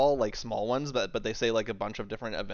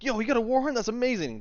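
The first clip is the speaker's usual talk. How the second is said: overjoyed squeal; 12 semitones higher, faster than usual. Acted. Shopkeeper Swain meeting the adventurers, squealing with delight. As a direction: squeal like he just met his idol. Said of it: "He's just like".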